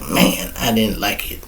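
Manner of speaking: a quiet, sorry-for-himself grumble; hushed complaint. Retold or imitated. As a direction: drop almost to nothing, glum. Quiet take before repeat.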